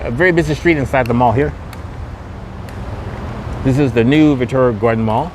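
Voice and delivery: usual speaking voice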